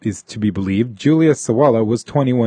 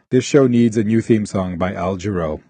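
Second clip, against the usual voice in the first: ordinary talk, nothing marked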